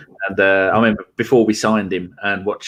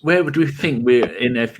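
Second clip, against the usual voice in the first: normal talking voice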